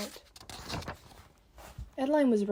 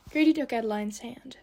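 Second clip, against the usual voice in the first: usual speaking voice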